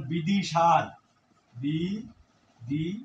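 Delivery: বিদিশার said slowly, each syllable stretched; slow and drawn out. Shown as a demonstration. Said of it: "আমি একটি উদাহরণ দিয়ে".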